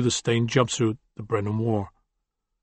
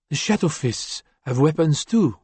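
put-on light French accent, cold and firm; cool woman with a trace of French; 4 semitones higher than usual. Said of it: "with just a lingering trace of a French accent".

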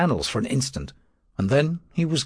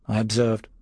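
ordinary delivery

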